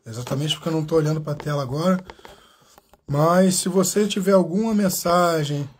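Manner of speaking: normal talking voice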